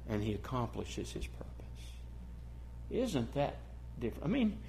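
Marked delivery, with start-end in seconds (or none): 0.1-1.5 hushed whisper. Real, not acted. Preacher to congregation about God's purpose, whispered. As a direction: drop to a whisper for emphasis. Quiet close of sermon point.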